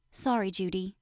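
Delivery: put-on a little boy apologizing quietly; quiet apology. A narrator's reading. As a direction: say it softly to your hurt sister. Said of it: "he says quietly".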